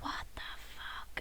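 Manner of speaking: breathy whispered what the fuck. Retold or imitated. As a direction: mutter it under your breath. Reaction to mispronounced name.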